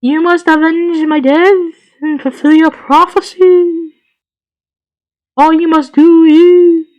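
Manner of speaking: slow, high, hammed-up dying spirit; overacted death scene. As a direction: drag out each word like a ghost. Dying ghost gives prophecy.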